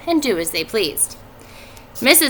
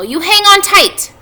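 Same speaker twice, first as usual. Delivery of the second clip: worried mother; 8 semitones higher, 8 dB louder than usual. A narrator's reading. Mrs. Arable warning her children at the fair, anxious. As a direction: fuss over the kids like an anxious mom. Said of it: "said Missus Arable".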